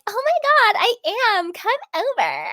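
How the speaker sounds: hammed-up high giddy pick-me voice; pick-me squeal. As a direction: squeal it like a text from him made your night. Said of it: "such, like, a pick me".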